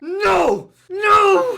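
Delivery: put-on high shouted no in Swedish accent; anguished shout. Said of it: "Do your best Swedish accent".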